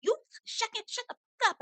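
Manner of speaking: high-pitched imitation of an angry retort; high, sputtering mock outrage without shouting. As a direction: mimic someone getting mad, voice shooting way up. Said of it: "here go Lisa getting mad".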